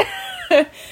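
sudden high-pitched burst of laughter. Real, not acted. Friend laughing high at the guessing game. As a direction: squeal a laugh. Friends joking about who broke it.

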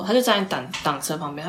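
ordinary delivery

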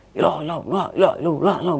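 imitated indistinct babble of many voices; crowd-like chanting. Voices heard from inside the tent, imitated crowd-like chanting. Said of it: "Kayak banyakan gitu".

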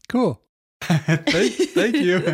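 laughing. Real, not acted. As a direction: giggle out the thanks. Laughing thanks.

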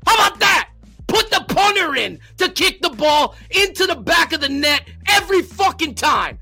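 loud, high furious yelling at the coaches; furious shouting. Real, not acted. Fan ranting at the team's coaches, furious shouting. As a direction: let it rip, full-throated rage.

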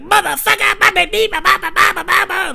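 high, loud garbled ranting; mock drunk babble. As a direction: blurt nonsense like a rowdy drunk. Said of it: "he's like".

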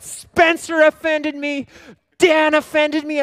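hammed-up petulant grumbling; mock whiny complaint. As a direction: whine like a sulking child. Pastor acts out his huff.

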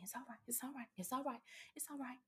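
very soft, high, hushed reassurance; high, quiet comforting murmur; soothing parent. Acted. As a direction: soothe a crying child, softly and up high. Voicing a parent soothing a child.

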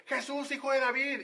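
blind man shouting. Retold or imitated. Blind beggar calling to Jesus, acted shout. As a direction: call out to Jesus from the roadside. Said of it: "Bartimeo le llamó gritando".

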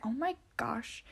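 slow, hushed whisper; whispered gossip. Retold or imitated. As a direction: whisper it so the crier won't hear. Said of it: "they're like".

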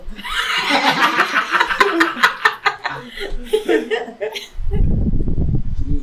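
high drawn-out laughter; long laugh. Real, not acted.

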